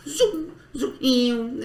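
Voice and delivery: high, slow playful sound effect; excited zooming. Retold or imitated. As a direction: squeal it like a zooming dog. Dog zooming around, imitated.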